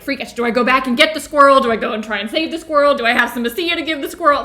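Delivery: loud, rapid-fire retold panic; freaking out. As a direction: re-enact frantic dithering, fast and loud. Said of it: "freaking out".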